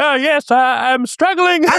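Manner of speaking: hammed-up falsetto pretending to be someone else; silly disguise voice. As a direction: penitent faking a voice so the priest won't know him. Disguised penitent voice in joke.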